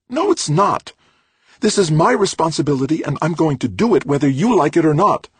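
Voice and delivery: loud, high-pitched angry outburst; furious protest. A narrator's reading. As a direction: fight back at full pitch, defiant. Trudy erupts in acted quarrel.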